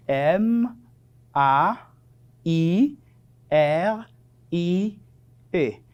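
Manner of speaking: letter by letter, pause after each; each letter separate, as in dictation; spelling aloud. Shown as a demonstration. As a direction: spell it out slowly for learners. Teacher spells the word aloud.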